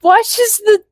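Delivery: high, loud ad announcer; infomercial voice. Pretend. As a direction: hype it like a TV commercial. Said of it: "sound like an infomercial".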